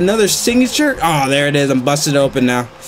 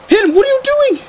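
much higher, louder cry of exasperation; exasperated yell; 4 dB louder, faster than usual. Real, not acted. Gamer yelling at his unresponsive wrestler. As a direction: yell at the game in frustration. Game not responding to button presses.

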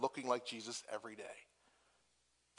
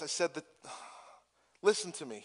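ordinary talk, nothing marked

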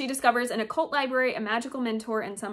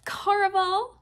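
excited reveal; 9 semitones higher, 4 dB louder, slower than usual. Real, not acted. Reveals her prized unicorn books.

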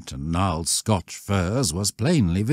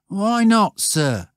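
sulky; 13 semitones higher, 4 dB louder, slower than usual. A narrator's reading. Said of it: "the sulky one of Stanley Judkins".

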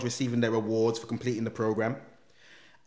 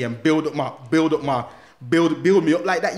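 louder with each repeat; rising frustration, getting louder; building indignation; 3 semitones higher, 8 dB louder than usual. Real, not acted. Indignant at the prank.

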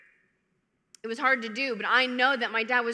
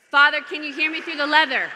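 loud, raised comic call; 5 semitones higher, 9 dB louder than usual. Pretend. Penitent calling to the priest through her purse, calling out. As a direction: project it as if through a barrier.

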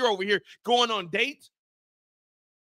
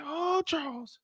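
mock sighing wife; 3 semitones higher, 4 dB quieter, slower than usual. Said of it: "She said".

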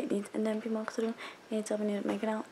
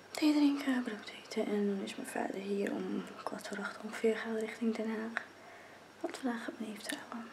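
quiet early morning whisper; 7 dB quieter than usual. Real, not acted. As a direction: whisper so nobody wakes up. Early morning, whispering to not wake.

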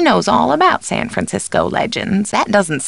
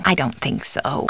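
low, soft disagreement; subdued doubt; 6 semitones lower, 7 dB quieter than usual. Acted. Housekeeper doubting the caller, subdued. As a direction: drop it low and quiet. Alternate takes of stock line.

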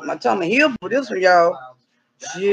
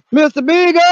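calling out; 6 semitones higher, 5 dB louder than usual. Acted. Owner calling her lost dog through the woods, loud call. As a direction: call the dog's name loud and high.

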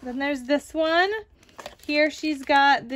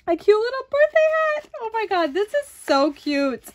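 delighted squeal; 8 semitones higher, 4 dB louder than usual. Real, not acted. Gushing over a gift hat.

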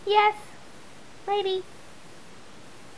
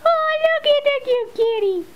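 high cooing voice; 10 semitones higher than usual. Real, not acted. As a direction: coo it up high at a pet. Cooing at the dog.

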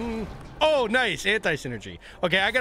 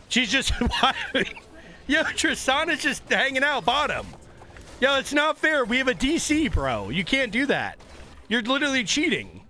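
outraged; 9 semitones higher than usual. Real, not acted. Outraged at enemy split push.

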